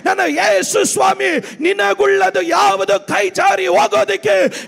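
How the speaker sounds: high, fervent sermon cry; full-force preaching. Real, not acted. Pastor assuring believers nothing of theirs slips away, full-force preaching. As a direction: keep proclaiming with full conviction.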